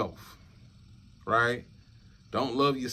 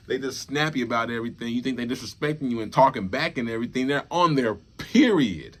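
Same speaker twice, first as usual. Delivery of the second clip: louder, laughing rant about periods; exasperated, loud, half-laughing rant; heated rant; 3 semitones higher, 6 dB louder than usual. Real, not acted. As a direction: get worked up and lecture the brothers. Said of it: "that rant, that conversation".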